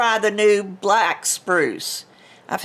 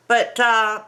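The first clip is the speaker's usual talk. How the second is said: ordinary talk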